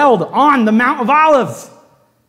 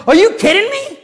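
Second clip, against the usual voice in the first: exasperated disbelief; 13 semitones higher, 4 dB louder than usual. Retold or imitated. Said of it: "and he's like".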